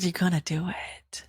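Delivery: hushed whisper of God's command. Retold or imitated. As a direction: breathe it like a secret instruction.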